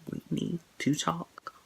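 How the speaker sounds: slow hushed quote, dreading it; soft dread. Retold or imitated. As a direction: let the words hang, quietly. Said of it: "Those words were words".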